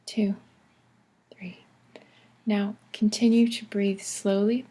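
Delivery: measured breathing-exercise voice with long pauses; guided breathing. Acted. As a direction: count breaths calmly, leaving room between words. Leads counted breathing exercise.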